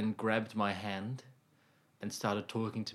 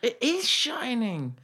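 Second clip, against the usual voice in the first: high sing-song echo; 13 semitones higher, 6 dB louder, slower than usual. Acted. Echoing partner, sung high.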